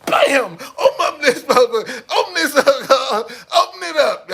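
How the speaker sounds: high, loud pounding yell, breaking into laughter; mock yell at a locked door. Acting kicking the door.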